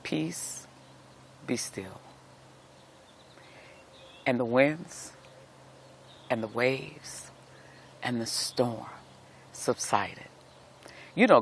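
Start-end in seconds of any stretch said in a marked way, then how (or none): 0.0-2.0 much softer, calm and still; hushed command. Acted. Jesus quietly stilling the sea, soft calm command. 4.2-10.3 softer, fading to a near whisper; hushed narration. A narrator's reading. Preacher retelling the storm's calm, softening to a hush.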